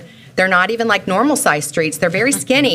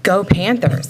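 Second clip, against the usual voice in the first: ordinary delivery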